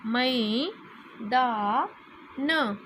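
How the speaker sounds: मैदान stretched out slowly, sound by sound; slow syllable-by-syllable. Shown as a demonstration. Teacher slowly sounding out a word for children. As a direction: draw out मैदान for the class. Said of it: "मात्रा को साथ में मिला करके बोलें".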